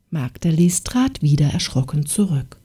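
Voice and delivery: ordinary delivery, nothing marked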